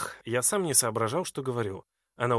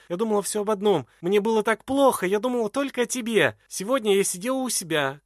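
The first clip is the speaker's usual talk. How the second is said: high, loud desperate outpouring; crying out loud; 6 semitones higher, 5 dB louder than usual. A narrator's reading. Edwarda cries aloud to Glahn not to break her heart. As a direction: cry your heart out to him. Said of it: "кричит в голос".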